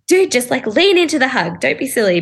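light mock scolding of a stiff friend; playful coaxing. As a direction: gently chide her into the hug. Coaxing friend to hug back.

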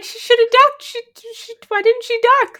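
very high squeaky imitation of a baffled girl; mock airhead. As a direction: squeak it out, dumbfounded and silly. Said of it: "she's like".

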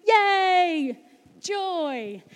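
a high bright mock cheer for the joyful camp; playful sarcastic yay. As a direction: cheer it like a sugary optimist. Voicing cheery camp one people.

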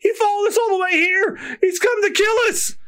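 high, wailing terror; panicked pirate. Acted. Pirate seeing the devil who sank the ships, panicked. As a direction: cry out high as if doomed.

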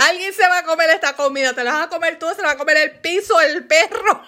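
rapid, high-pitched mock tirade; hammed-up threat tumbling out fast; fired up. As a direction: rant quickly, close to laughing. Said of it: "yo me enciendo de u-, de uno a mil súper rápido".

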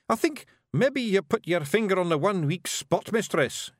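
high Scots admission; admitting Scottish Feegle. A narrator's reading. Rob conceding the plan's weak spot to Miss Level, admitting. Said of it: "he admitted".

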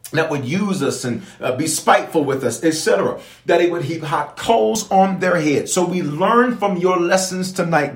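rising, fervent preaching prayer. Real, not acted. Pastor praying over the church, fervent and rising. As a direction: pray it out with building fervor.

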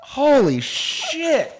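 high, slow, laughing exclamation; shocked shout. Real, not acted. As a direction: let the shock burst out laughing. Right after the smelling salts.

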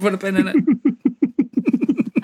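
high, drawn-out laughing repeat. Real, not acted. Echoing co-host's wrap-up phrase, laughing. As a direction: break up laughing on the words.

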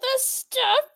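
joking about indie filmmakers, laughing; laughing mock line. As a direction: repeat it while cracking up.

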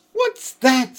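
high, loud startled cry; girl frightened by a loud noise; startled fright. Acted. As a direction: jump at the sudden crash. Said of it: "clutching Susan's arm".

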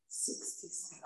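whispered, tense; tense whisper watching the clock; whispered count. Retold or imitated. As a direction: whisper the number, holding out. Watching clock resist urge.

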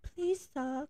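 put-on high, soft pleading child; pleading starving orphan. Starving kid at the orphanage, timid plea. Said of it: "this poor little kid who's, like, starving".

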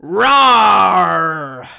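roaring like a giant ape; big monster roar; Kong roar. Shown as a demonstration. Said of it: "I was doing a Kong intro".

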